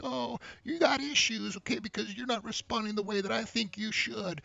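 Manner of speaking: high, petulant mock whine; whining guy. Guy complaining she didn't respond, whining high. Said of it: "Instead of whining".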